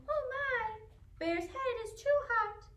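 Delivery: a hint of a high little mouse voice; worried mouse. A narrator's reading. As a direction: fret like a tiny mouse. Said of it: "Mouse mutters".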